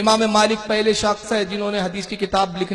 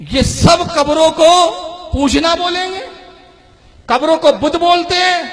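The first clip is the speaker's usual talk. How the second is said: raised, high voice of protest; indignant preaching; 7 semitones higher, 7 dB louder than usual. Real, not acted. Preacher denouncing opponents who call graves idols, indignant. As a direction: call out the accusation loudly.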